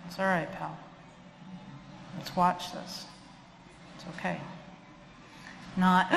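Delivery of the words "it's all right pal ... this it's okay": hushed reassurance beside a scared pup; quiet soothing. Shown as a demonstration. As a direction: settle a nervous dog gently. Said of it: "my voice is nice and quiet".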